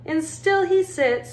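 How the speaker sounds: plain reading voice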